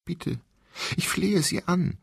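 high, pleading cry; desperate begging. Acted. Captive woman begs the voice, terrified. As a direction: plead with everything left.

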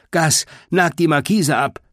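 high-pitched, urgent order; commanding. A narrator's reading. Boar Greta ordering Gus about the awning, commanding. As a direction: give the order without hesitation. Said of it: "befahl Greta".

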